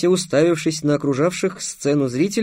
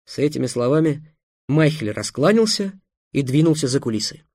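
usual speaking voice

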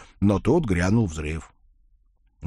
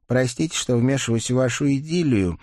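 plain reading voice

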